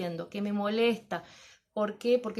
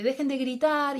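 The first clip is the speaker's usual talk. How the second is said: teacher's fed-up shout at the class, louder; exasperated order; 5 semitones higher, 5 dB louder than usual. Retold or imitated. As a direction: bark it at the children to stop yelling. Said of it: "o es, es, eh".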